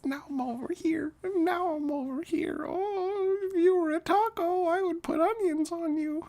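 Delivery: high, softened falsetto parody; mock ASMR lady. Streamer mocks ASMR for chat, high soft lady voice. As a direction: play a cooing ASMR woman, high and silly. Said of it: "I'm an A S M R lady".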